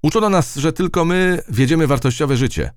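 high voice, speeding up into worry; ant soldier. Acted. Ant remembers its commander, high voice turning hurried. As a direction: start high and end in a fast fret.

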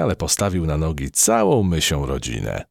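usual speaking voice

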